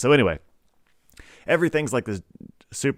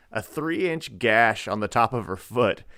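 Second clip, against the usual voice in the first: ordinary talk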